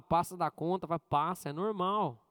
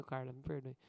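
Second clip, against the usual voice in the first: much softer, lower, sincere apology; humble apology; 8 semitones lower, 10 dB quieter than usual. Retold or imitated. Pastor retelling his apology to Carla, soft and humble. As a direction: say sorry gently and meekly.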